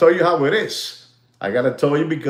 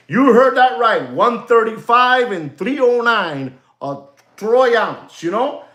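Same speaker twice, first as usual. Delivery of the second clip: pitched up high and pushing, selling the big number; excited hype; 8 semitones higher than usual. Real, not acted. Host hyping silver forecast to viewers, excited and pitched up. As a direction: sell the shocking price like breaking news. Hyped silver price reveal.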